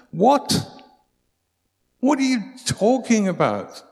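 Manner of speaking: high, loud mock disbelief; incredulous. Preacher mimicking a baffled listener, loud and high.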